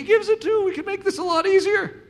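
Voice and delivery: high, laughing half-joke to God; laughing plea. Retold or imitated. Eric jokingly bargains with God, laughing. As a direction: laugh as you bargain for an easier way.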